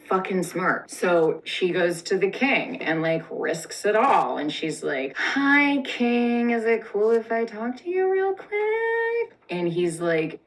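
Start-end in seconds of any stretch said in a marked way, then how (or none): 5.2-9.3 high, sugary and careful; sweet girlish voice. Acted. Esther sweetly asks the scary king.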